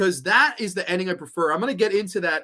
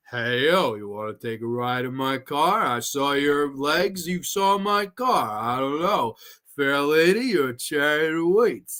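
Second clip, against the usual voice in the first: low, slow impression, drawn-out words; Stallone; 4 semitones lower, slower than usual. Imagining the actor as Stuntman Mike, slow low impression. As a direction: slur it slow and low like a movie tough guy. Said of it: "Stallone as Stuntman Mike".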